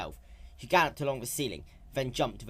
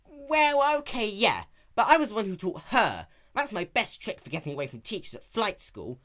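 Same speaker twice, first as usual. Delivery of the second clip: raised, brash tomboy pony voice; lightly raised young heroine; Rainbow Dash voice; 10 semitones higher, 7 dB louder than usual. Acted. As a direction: play her sporty and cocky.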